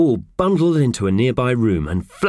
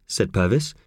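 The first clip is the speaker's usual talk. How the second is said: ordinary delivery, nothing marked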